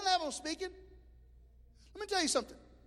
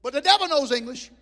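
loud insistence; 5 semitones higher, 13 dB louder than usual. Retold or imitated. Retold rebuke.

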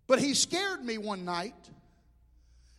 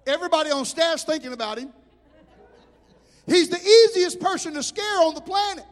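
ordinary talk, nothing marked

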